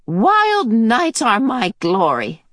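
high, slow and relishing; gleeful crone. Acted. Mrs Watson boasting to Mrs Murray, slow high relish. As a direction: savour the wild night.

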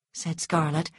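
ordinary delivery

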